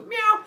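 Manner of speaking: high, loud cat cry; snake meow. Shown as a demonstration. Giant snake's substitute hiss, meowing loudly. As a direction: demonstrate a silly piercing meow. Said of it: "what kind of sound a snake makes".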